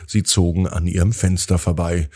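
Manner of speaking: usual speaking voice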